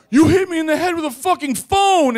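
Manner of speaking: loud, high-pitched shout of accusation; acted outraged yell. Bloodied boyfriend accuses girlfriend, acted outraged yell.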